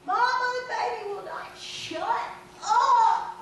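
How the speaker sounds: put-on high, loud child's cry; little girl shouting to her mother; hollering child. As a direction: holler for mama in a panic. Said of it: "she's hollering for her mother".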